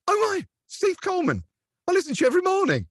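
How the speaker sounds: high, gushing fan voice; excited fan. Retold or imitated. Fan spotting the DJ at a stall, high starstruck gush. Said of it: "he just looked at me and went".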